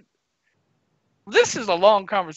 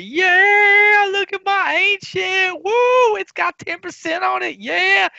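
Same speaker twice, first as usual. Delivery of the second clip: hammed-up, much higher, excited gloating; mock gleeful; 11 semitones higher, 5 dB louder, slower than usual. Player bragging about his ancient drop, mock gleeful. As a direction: show off the new item like a kid. Mocking gamer proud of loot.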